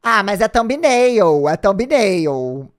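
slow, loud shocked protest. Real, not acted. Streamer spots his crying thumbnail, slow shocked protest. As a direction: stretch out thumbnail in disbelief.

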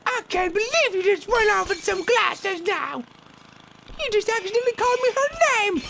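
very high loud squeaky voice; high squeaky girlfriend. Pretend. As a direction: play the jealous girlfriend flake, shrill and shocked. Girlfriend flake scolding.

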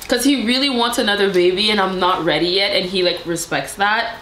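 ordinary talk

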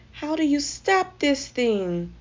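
high, slow, loud shout. A narrator's reading. Timothy on the speeding snowball, alarmed shout. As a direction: shout it out with punched words. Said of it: "Timothy shouted as they zoomed along".

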